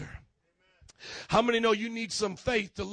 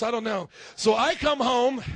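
ordinary talk, nothing marked

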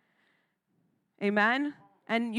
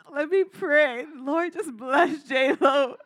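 mock pious prayer; 4 semitones higher, slower than usual. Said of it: "I'm like".

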